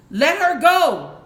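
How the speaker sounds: loud, high, slow pirate roar; Captain Hook roar. Acted. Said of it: "Leonard Shay roared, still sounding like Hook".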